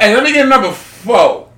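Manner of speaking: loud, high caricature of ordering; hammed-up customer impression; mock soul food customer. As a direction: belt out the order in a put-on voice. Imitating friend ordering at soul food kitchen.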